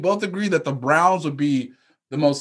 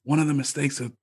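low, soft, hushed aside; whisper; 6 semitones lower, 9 dB quieter than usual. Pretend. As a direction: whisper it like a secret. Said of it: "I would also like to whisper with you".

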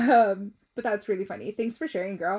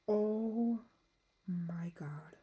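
breathless whisper, barely voiced; whispered awe; 8 dB quieter, much slower than usual. Real, not acted. Whispering to herself after a touching story, in awe. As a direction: hush it out in stunned awe. Moved by the star gift.

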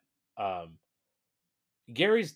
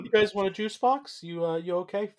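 higher, eager helper voice; mock Jeremy voice; 10 semitones higher, 5 dB louder than usual. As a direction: offer it up high and hopeful. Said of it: "voicing Jeremy".

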